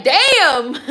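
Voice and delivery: mock surprised damn. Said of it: "Owen's like".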